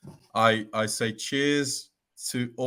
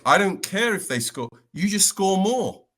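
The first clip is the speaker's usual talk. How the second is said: a hint of George, pitched up and louder; mock-exasperated coach brushing it off; lightly voiced coach shrugging off goals conceded; imitating George; 10 semitones higher, 4 dB louder than usual. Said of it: "because he was like".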